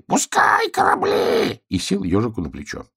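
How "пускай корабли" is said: very high, stretched parrot cry; parrot squawk. Acted. Tiny parrot orders hedgehog, high squawk. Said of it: "крикнул попугай".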